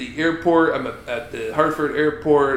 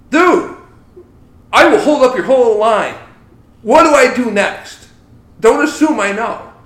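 louder, higher frustrated venting; exasperated rant; 8 semitones higher, 9 dB louder than usual. Real, not acted. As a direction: vent like you've had enough. Ranting about the agent.

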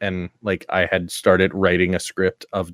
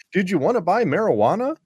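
mock high voice; 10 semitones higher than usual. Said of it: "you didn't say".

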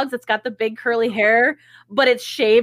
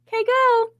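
eager go-ahead; 11 semitones higher than usual. Retold or imitated. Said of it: "And I'm like".

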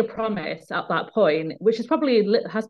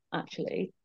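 quiet admission; 12 dB quieter than usual. Retold or imitated. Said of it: "And she went".

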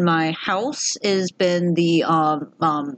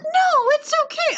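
squeaky reassurance. Retold or imitated. Comforting the receptionist, very high chirpy reassurance. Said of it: "I'm like".

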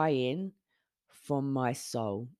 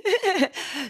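laugh; 4 dB louder than usual. Real, not acted. Laughing at friend's cheeky request.

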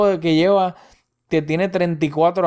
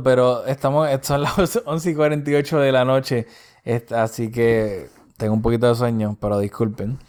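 normal talking voice

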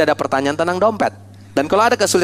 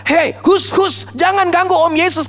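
high-pitched mock scolding; acted-out telling-off; scolding disciples; 11 semitones higher than usual. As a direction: shoo the children away, high and brisk. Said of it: "Murid-murid Yesus memarahi anak-anak".

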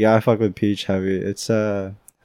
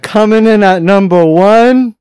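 raised, loud game-show build-up; countdown host announcement; 9 semitones higher, 7 dB louder than usual. Pretend. Announcing the number one fruit to friends, game-show hype.

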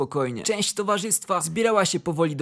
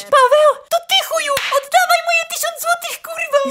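shrieking, much higher and louder; screaming furious; 8 dB louder than usual. Acted. Kasia recognising Paweł among carolers, furious shriek. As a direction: scream at him to give the money back. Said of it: "zaczęła się drzeć".

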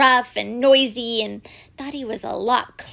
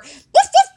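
loud high yapping woof woof; dog barks; 13 dB louder than usual. Acted. Toby barks at the teddy bear, loud and high. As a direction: bark like an excited little dog. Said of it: "barked Toby".

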